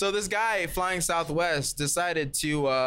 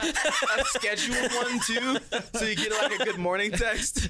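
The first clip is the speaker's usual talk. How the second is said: slow, laughing and teasing; 5 semitones higher, slower than usual. Real, not acted. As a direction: giggle through the joke. Joking, laughing through it.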